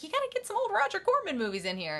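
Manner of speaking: high, hurried fan's plea; imitating a keen movie lover; enthusiastic Elvira. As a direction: urge it eagerly, pitch up. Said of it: "she says it enthusiastically".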